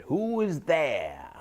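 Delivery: put-on old wise woman. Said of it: "he heard".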